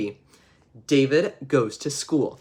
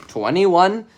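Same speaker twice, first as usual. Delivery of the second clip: louder, higher questioning guess; clueless guess; 4 semitones higher, 6 dB louder than usual. Acted. David shouts a wrong answer to the class, loud and unsure. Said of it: "he's like".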